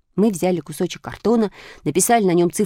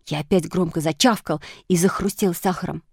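ordinary delivery

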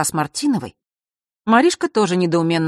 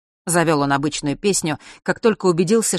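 plain reading voice

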